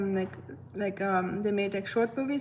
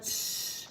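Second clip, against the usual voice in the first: whispered tsh of a sizzling burn; sizzle noise; 11 dB quieter than usual. Retold or imitated. Imitating the burn sizzling on her skin, a hissed tsh. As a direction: hiss out the sizzle sound. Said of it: "It was like".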